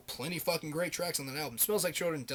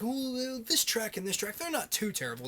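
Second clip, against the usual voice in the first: much higher, defensive fan voice; mock diehard fan; 8 semitones higher than usual. Mocking diehard Metallica fans defending bad songs, much higher. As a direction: sneer at their excuses. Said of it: "diehard Metallica fans will be like".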